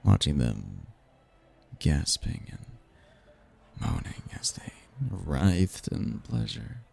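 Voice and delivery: soft, low, lingering on each phrase; sultry reminiscence. Acted. Demon savoring a memory of tempted humans, hushed and sultry.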